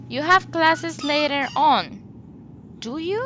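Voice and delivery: "do you" jumps high at the end; unsure, lift "you" sharply; exaggerated rise on "do you"; rising tag question. Shown as a demonstration. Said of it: "I am raising the intonation".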